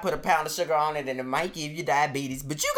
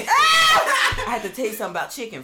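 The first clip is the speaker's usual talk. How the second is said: laughing; 10 semitones higher, 7 dB louder than usual. Acted. Restarting the topic loudly, laughing.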